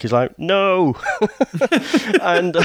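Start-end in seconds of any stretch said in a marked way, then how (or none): 0.4-0.9 mock refusal. Acts Brent's refusal of callers.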